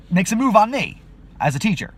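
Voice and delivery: ordinary talk, nothing marked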